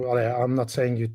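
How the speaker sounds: normal talking voice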